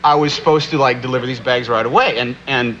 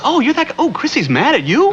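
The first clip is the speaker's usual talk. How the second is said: voicing Billie Jean King; 11 semitones higher than usual. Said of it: "She says".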